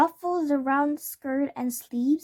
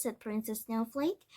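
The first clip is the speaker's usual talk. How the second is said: plain reading voice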